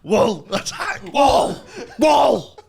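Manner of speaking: high, loud barked shouts; shouting. Pretend. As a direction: bark it out like an attacking dog. Dog voiced attacking a wolf.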